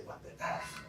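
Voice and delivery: hushed inner voice, half under the breath; thought to herself. Retold or imitated. After the crash, rehearsing what she should say, hushed inner voice. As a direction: murmur it as a private thought. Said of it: "I'm supposed to say".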